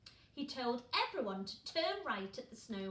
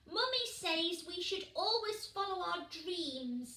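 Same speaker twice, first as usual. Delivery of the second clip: higher and louder; earnest small penguin; high little chick; 7 semitones higher, 5 dB louder than usual. Acted. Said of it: "said Peaky".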